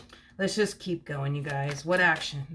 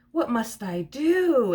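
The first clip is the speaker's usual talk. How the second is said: high, loud, drawn-out mock despair; theatrical plea, slowly stretched; hammed-up dramatic; 6 semitones higher, 6 dB louder, much slower than usual. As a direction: wail it like a melodrama heroine. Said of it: "they're so dramatic".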